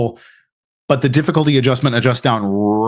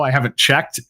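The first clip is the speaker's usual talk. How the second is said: usual speaking voice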